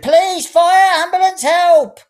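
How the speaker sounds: high, loud hammed-up cries for help; screaming for help. A narrator's reading. Old woman screams for help after falling, high and loud. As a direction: yell each word for the whole street.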